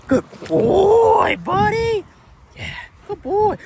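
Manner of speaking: high, loud praise for the dog; squeaky praising a dog. Real, not acted. Praising dog after a catch.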